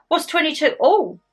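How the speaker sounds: ordinary delivery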